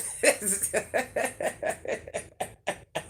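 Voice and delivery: high breathy laugh dying away; helpless laughter. Real, not acted. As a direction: laugh in airy bursts, slowly running out. Laughs at own slip.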